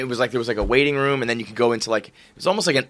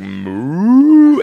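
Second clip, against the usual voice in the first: long, loud, rising moo; imitated cow call; 5 semitones higher, 5 dB louder than usual. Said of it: "but I nailed a moo".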